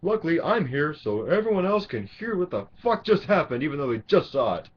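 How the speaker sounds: high, loud, sarcastic mimicry of a know-it-all; mocking moviegoer. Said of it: "I don't need you sitting there going".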